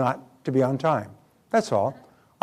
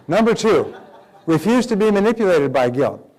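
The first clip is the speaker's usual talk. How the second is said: laughing; 5 semitones higher, 5 dB louder than usual. Real, not acted. Laughing while announcing point.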